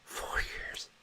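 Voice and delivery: breathy hushed aside; dramatic little whisper; mock whisper. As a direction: whisper it like a secret. Marks the show's fourth anniversary.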